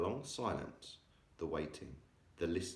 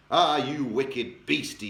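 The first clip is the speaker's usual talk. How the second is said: scolding cry; 5 semitones higher, 8 dB louder, slower than usual. A narrator's reading. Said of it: "he cried".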